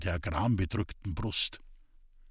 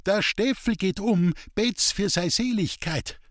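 high-pitched mild shout; crowd's cry; 8 semitones higher than usual. A narrator's reading. Villagers warning that Steffel's ghost walks, calling out. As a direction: voice a village crowd's alarmed call. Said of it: "aus hundert Kehlen schallte es".

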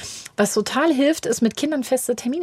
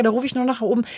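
ordinary talk

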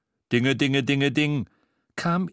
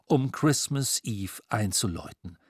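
ordinary delivery, nothing marked

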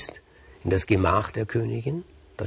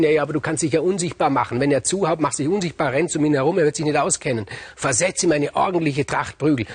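urging queen; 7 semitones higher, 4 dB louder than usual. Acted.

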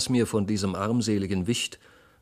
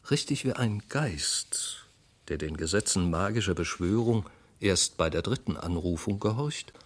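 usual speaking voice

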